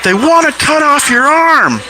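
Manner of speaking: high, drawn-out call across a room; retold holler. Nurse hollering at a patient across from her, high mock holler. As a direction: imitate hollering, slow and high-pitched. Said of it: "hollers at him".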